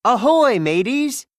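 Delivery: loud pirate greeting; cheerful pirate hail; hearty call. Acted. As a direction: call out to the crew with gusto. Host greeting viewers, calling out.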